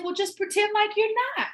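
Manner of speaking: high, sassy push of confidence; sassy advice. Retold or imitated. Driver hyping up her passenger, high and sassy. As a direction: toss it off with a high sassy lilt. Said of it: "I said".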